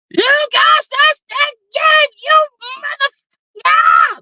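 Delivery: put-on high squealing child's voice, slow and stretched; hammed-up child cheering at the game; childish screaming. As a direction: scream it like a little kid losing it. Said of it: "I would have sounded like a child".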